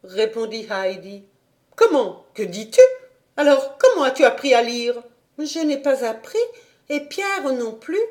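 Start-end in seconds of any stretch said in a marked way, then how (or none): 1.8-5.0 much louder, higher shocked questions; shocked governess. A narrator's reading. 5.4-8.0 put-on simple child. A narrator's reading.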